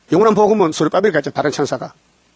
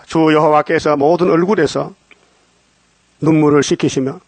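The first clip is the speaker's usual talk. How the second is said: solemn scripture reading; slower than usual. Acted.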